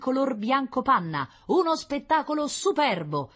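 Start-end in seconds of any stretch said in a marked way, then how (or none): 1.5-3.3 high-pitched, excited radio report; excited scout. Acted. Scout reporting to the general by radio, high and excited. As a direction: report the sight with high wonder.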